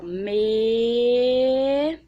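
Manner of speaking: medalhão stretched very slowly; drawn-out demo word. Shown as a demonstration. Teacher to children, sounding out medalhão slowly. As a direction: draw out medalhão while writing it. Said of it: "E vou escrever".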